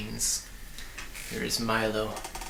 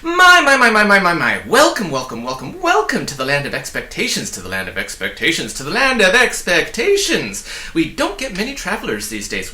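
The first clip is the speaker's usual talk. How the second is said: fast excited little man; 2 semitones higher, 8 dB louder than usual. Acted. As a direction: bubble over with welcome, words tumbling. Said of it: "speaking as fast as he could and repeating everything".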